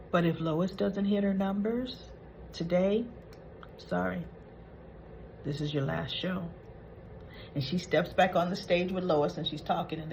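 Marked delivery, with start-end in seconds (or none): 0.1-6.4 lowered, softer ultimatum; quiet warning. Retold or imitated. Aside to co-hosts that it's the last show, quietly ominous. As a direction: drop the voice as the threat lands.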